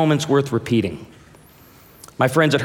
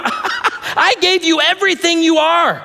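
high, slow, indignant retort with a laugh; defiant old father; 11 semitones higher than usual. Pretend. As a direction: put the daughter in her place. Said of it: "I'm gonna remind them".

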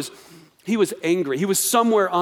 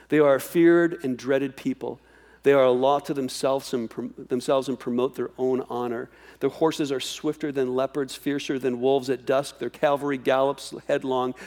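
lower, grave reading of the coming threat; ominous scripture reading; 4 semitones lower, 3 dB quieter than usual. Acted. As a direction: read the Babylonian threat darkly and steadily. Reads God's words from scripture.

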